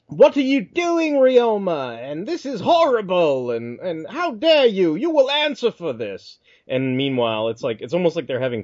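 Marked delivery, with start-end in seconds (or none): 0.1-6.4 high, hammed-up British accent; English guy voice. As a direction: play the outraged Englishman, pitched way up. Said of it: "the English guy's like".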